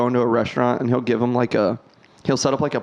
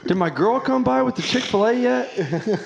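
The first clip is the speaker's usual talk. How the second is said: bossy manager voice; 10 semitones higher than usual. Acted. Acting a boss asking staff.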